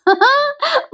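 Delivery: real high-pitched giggle; helpless laugh. Real, not acted. Narrator enjoying the serial's silliness, giggling. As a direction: burst into a high, amused laugh. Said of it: "येतं बाई हसू मला".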